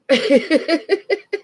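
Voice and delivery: high-pitched chuckle; a run of high, quick giggles; laughing. Real, not acted. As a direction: laugh at your own joke. Laughs after telling own joke.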